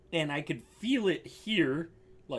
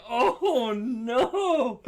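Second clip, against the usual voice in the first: higher, louder mock-dismayed groan; laughing dismay; 5 semitones higher, 4 dB louder than usual. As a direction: retell your horror while cracking up. Retold dismay amid laughter.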